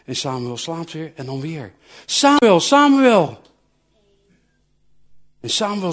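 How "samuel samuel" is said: high, loud call from afar; a loud, high voice calling through the night. Acted. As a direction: call the boy's name across the dark. God calls Samuel again.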